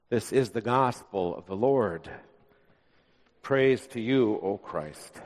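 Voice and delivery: low, soft liturgical call; liturgical response. Acted. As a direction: close the reading reverently. Liturgical closing words.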